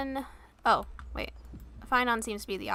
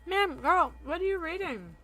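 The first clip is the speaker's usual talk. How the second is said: playful calling; 5 semitones higher than usual. Pretend. Player calls to character again.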